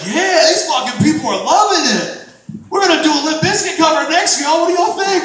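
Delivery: high, loud rock-frontman hype; mock a deluded singer pumping the crowd; clueless band frontman. Comic imitating a bad band addressing the crowd, loud hype. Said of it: "they're just sitting there like".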